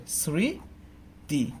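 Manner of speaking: স্মৃতি drawn out slowly as "sri... ti"; silent m, syllable by syllable. Shown as a demonstration. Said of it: "আমার উচ্চারণটা কি হবে?". (স্মৃতি ধীরে ভেঙে "স্রি... তি" উচ্চারণ)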